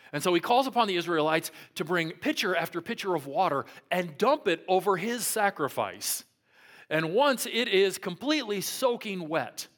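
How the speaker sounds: usual speaking voice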